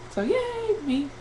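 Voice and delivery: small, high drawn-out yay; a playful, soft high cheer for yourself; mock cheer. Vlogger celebrating her own weight loss, mock cheer.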